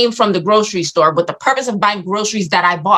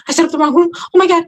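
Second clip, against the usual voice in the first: high-pitched dawning panic; imitated tearful dismay; 10 semitones higher, 3 dB louder, faster than usual. Nurse learning she set up the wrong room, imitated tearful dismay. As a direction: act out horror just before tears. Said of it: "And I just started crying".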